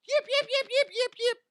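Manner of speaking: high yappy little dog barks; squeaky lapdog yaps; imitated yapping. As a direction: yip like a tiny yapper dog. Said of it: "those little yapper dogs".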